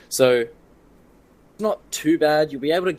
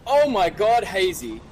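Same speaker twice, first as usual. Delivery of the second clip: high, excited shoutcasting; thrilled esports commentator; live play-by-play; 8 semitones higher than usual. Real, not acted. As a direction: build to an amazed cry as the play lands.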